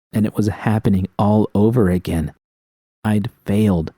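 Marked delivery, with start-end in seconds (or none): none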